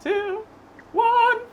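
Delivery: countdown rising in pitch, building; dramatic countdown. Real, not acted. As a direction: build drama with each number. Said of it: "Just to build some drama".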